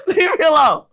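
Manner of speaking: real laughter breaking through a mock plea; giggling while begging to be left alone; laughing protest. Real, not acted. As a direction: laugh it out, still high and loud.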